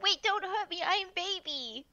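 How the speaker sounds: high, cutesy mock plea; small, high pretend-helpless voice; pleading baby. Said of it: "And I'm like".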